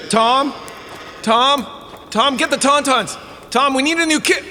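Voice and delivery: calling out. Acted. Camper calling into the night for Tom, rising alarm. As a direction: call out for your friend, growing uneasy.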